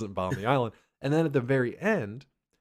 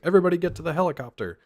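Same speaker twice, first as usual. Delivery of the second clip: higher, fast call to the helicopter; urgent call; 8 semitones higher, 4 dB louder, faster than usual. Retold or imitated. Character ordering escape, higher rushed call. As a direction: hurry everyone along. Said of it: "He's like".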